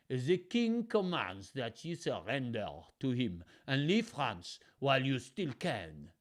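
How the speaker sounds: lightly voiced French messenger, stern; a hint of a haughty French envoy; French accent. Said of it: "He's got a different French voice".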